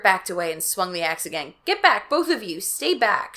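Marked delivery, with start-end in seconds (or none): 1.7-3.4 warning. Acted. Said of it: "she warned".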